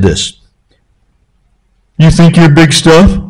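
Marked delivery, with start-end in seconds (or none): 2.0-3.2 lightly voiced old country preacher, higher and mocking; Papa Don's voice. Preacher retelling his mentor teasing the proud, light imitation. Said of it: "he talked like this".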